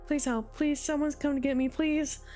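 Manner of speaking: desperate pleading. Acted. Josh trapped, pleading desperately for rescue. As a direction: beg anyone who can hear. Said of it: "the hysteria in his voice".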